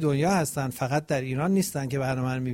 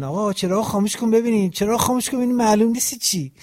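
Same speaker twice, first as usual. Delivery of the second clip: higher, louder mock complaint from viewers; lightly voiced grumbling viewer; imitating annoyed viewers; 6 semitones higher, 4 dB louder than usual. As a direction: mimic viewers who can't see. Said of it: "مردم اینجوری نگردن".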